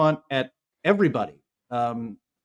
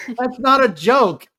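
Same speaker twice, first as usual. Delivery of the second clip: laughing; 8 semitones higher, 6 dB louder, slower than usual. Real, not acted. Mocking SNL's Obama jokes.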